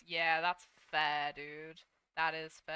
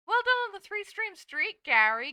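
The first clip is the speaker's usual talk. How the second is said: excited praise; 11 semitones higher, 11 dB louder than usual. Real, not acted. Praising a viewer.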